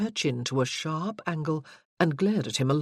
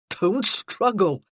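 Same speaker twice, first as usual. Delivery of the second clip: laughing; 3 semitones higher, 7 dB louder, slower than usual. A narrator's reading. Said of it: "laughing too".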